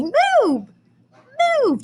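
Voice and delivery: high, excited "Move, move". Shown as a demonstration. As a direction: call out "Move" like it's thrilling. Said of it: "read it with exclamation or excited marks".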